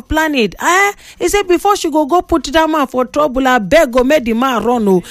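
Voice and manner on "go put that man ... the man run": usual speaking voice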